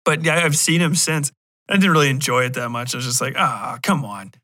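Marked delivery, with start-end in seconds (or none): none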